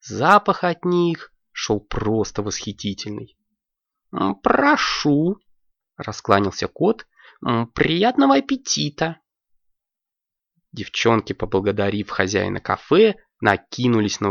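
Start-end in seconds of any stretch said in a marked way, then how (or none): 4.1-9.2 lightly voiced courteous cat host; a mild, hospitable waiter cat; a touch of a polite cat. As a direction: welcome guests slowly and politely. Cat serves.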